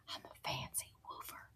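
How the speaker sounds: a playful whisper for the dog; whispered toy voice. Pretend. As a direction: whisper as the fancy woofer. Dog figurine speaking.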